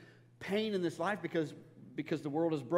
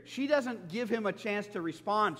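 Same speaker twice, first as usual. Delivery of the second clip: ordinary talk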